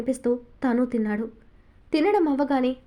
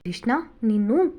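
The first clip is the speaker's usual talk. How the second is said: playful mock anger. A narrator's reading. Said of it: "చిరుకోపంగా".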